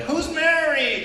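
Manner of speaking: hammed-up high shout-out; high quizzing call. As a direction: stretch it out like a game show host. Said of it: "I'll be like".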